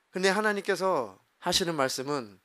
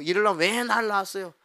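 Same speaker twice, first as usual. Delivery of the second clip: child's retold whiny protest; 앙탈 부리며 대들기; 3 semitones higher, 3 dB louder than usual. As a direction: act a child talking back to a parent. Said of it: "앙탈 부리고 막 부모한테 막 대들고". (부모에게 앙탈 부리듯 따지기)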